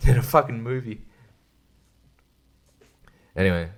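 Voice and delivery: ordinary talk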